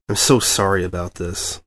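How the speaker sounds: ordinary delivery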